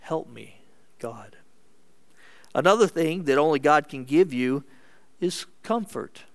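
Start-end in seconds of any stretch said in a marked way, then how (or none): 0.0-1.4 low, very soft plea; hushed little prayer to God; weak prayer. Acted. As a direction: pray it faintly, from weakness.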